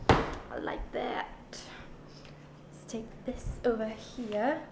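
hushed, slow whisper; whispered aside. Real, not acted. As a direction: whisper it slowly to yourself. Soft aside while fetching spoon.

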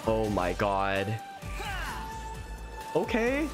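normal talking voice

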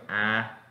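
"A" as in accurate, mouth open; demonstrated wide "A"; open A sound. Shown as a demonstration. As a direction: open up for a single "A". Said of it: "Ven que es como así".

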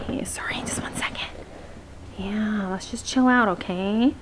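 whispered quick apology; hushed aside. Real, not acted. Pausing the vlog to settle the dog, hushed aside. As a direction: whisper it under your breath.

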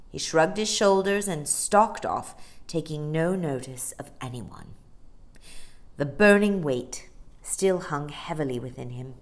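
ordinary delivery

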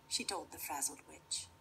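plain reading voice

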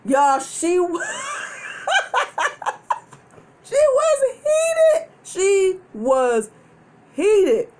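high squealed words through real laughter; laughing hard. Real, not acted. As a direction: crack up, stretching the words out. Cracking up at mom's anger.

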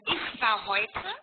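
slowed down, each word laid out for the learner; slow repeat. Shown as a demonstration. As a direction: say it again slowly and clearly. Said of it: "ein bisschen mehr dran". (langsam wiederholt)

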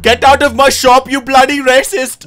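put-on loud, angry shopkeeper in Indian accent. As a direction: throw him out in an Indian accent. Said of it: "making fun of the way I talk".

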